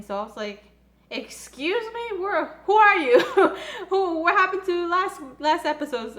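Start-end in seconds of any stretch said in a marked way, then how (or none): 1.1-2.2 much higher, slow "excuse me"; incredulous. Retold or imitated. Fan reacting to Yurio's rude remark, slow offended disbelief. 2.2-6.2 higher and louder, breaking into laughter; laughing in disbelief. Retold or imitated. Fan laughing at how different Yurio acts, high and laughing. Laughing through the outraged reaction.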